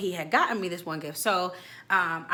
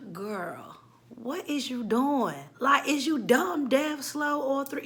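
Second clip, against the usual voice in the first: slow incredulous scold; 5 semitones higher, much slower than usual. Real, not acted. Mock scolding the friend.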